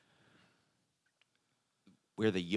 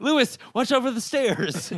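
laughing; 6 dB louder than usual. Acted. Joking threat with laughter.

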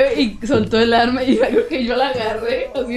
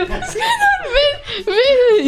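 much higher, laughing call to the dog; much slower than usual. Retold or imitated. Dreamer calls her dog Escándalo again, high and laughing. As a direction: cry out to the dog through laughter.

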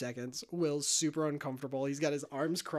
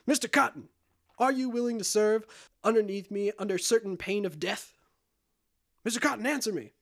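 raised, commanding questioning; acted captain's challenge; 5 semitones higher, 4 dB louder than usual. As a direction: address the crew member like a demanding captain. Jack questions crewman as captain.

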